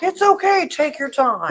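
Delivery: high, sing-song mock-soothing voice; mock reassurance. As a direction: parody the staff's breezy calm. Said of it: "When they're just like".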